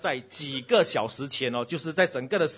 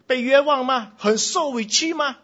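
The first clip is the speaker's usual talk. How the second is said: mock wronged outrage; 10 semitones higher, 11 dB louder than usual. Acting wronged child's upset.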